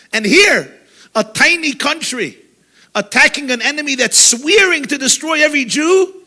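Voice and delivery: ordinary talk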